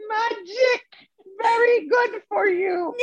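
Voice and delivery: put-on high, slow Balinese-accented old man, laughing; imitating Ketut. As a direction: stretch it out with comic glee. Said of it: "retelling Ketut's line".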